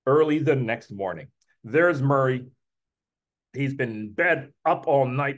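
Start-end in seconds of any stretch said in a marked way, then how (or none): none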